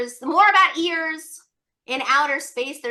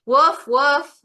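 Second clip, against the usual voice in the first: playful louder woof woof; mock dog bark; 6 dB louder than usual. Teacher barking like a dog to show ears.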